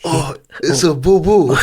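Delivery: high mock Japanese accent; exaggerated Japanese accent, laughing. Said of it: "in a Japanese accent".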